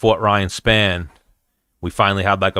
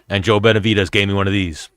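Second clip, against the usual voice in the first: ordinary talk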